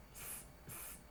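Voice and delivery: "s, s" as two bare voiceless hisses; S-sound demo. Shown as a demonstration. Teacher isolating the start of cera, hissed S. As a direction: hiss each "s" separately, no vowel. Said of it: "Aqui eu tenho o som do S".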